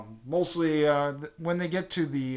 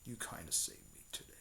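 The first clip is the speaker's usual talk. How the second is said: low, soft, ghostly whisper; Nine Tails whispering inside Sakura's mind, eerie; whispering demon fox; 8 semitones lower, 17 dB quieter than usual. Retold or imitated. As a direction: breathe it like a monster in her head. Nine Tails thanks her in mind.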